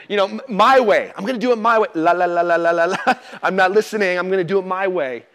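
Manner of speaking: laughing, mock-stubborn la la la; childish refusal. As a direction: play a kid covering his ears, laughing. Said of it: "you're just like".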